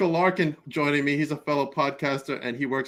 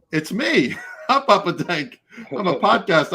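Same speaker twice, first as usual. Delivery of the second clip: laughing boast; 5 semitones higher, 3 dB louder than usual. Retold or imitated. Proudly telling the cop.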